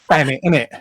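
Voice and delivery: broad London Thanet, innit; cockney Thanet innit, th as f; London accent. Shown as a demonstration. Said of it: "London accent".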